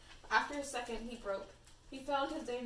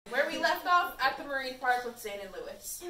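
recap announcer; 8 dB louder than usual. Pretend. Reader reopening the story after end of chapter, loud announcing. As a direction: announce the recap with a big launch.

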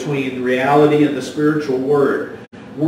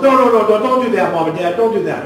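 higher and louder frantic no; pleading boy; 6 semitones higher, 6 dB louder, faster than usual. Retold or imitated. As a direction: kid begging them to stop.

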